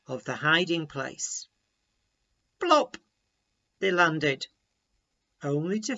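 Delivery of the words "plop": plop sound. A narrator's reading. Frogs land in cream.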